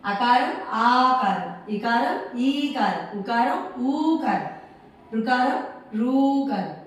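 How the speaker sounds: slow recitation, long vowels drawn right out; reciting the vowels. Shown as a demonstration. Teacher reciting vowel names for pupils, slow singsong drill. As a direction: recite slowly, stretching every long vowel. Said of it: "ఒకసారి అలా చెప్పండి".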